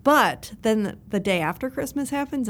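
ordinary talk, nothing marked

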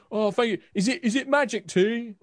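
high, loud, hopeful soldier voices; eager soldiers. Acted. Drunk soldiers ask the magister about the tea, eager and high. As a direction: ask like a tipsy soldier hoping for magic.

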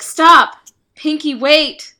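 loud, high, pleading cry; dramatic shout. Acted. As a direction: cry out dramatically to stop him. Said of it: "Very dramatically".

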